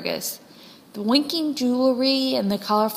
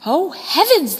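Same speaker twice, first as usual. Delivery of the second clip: louder and higher, astonished; amazed hens; 5 semitones higher, 5 dB louder than usual. A narrator's reading. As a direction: gasp it in wonder. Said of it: "They said".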